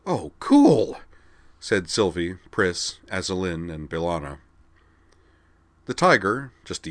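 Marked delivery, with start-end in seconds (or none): 0.0-1.0 loud, high, delighted exclamation; big impressed cheer; excited awe. A narrator's reading. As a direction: burst out thrilled, voice way up. Group of characters thrilled at the tiger.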